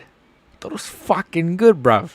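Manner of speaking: high, slow mock English accent. Streamer repeating his protest to chat, English accent. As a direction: drag it out as a London roadman accent. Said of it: "work on the English accent".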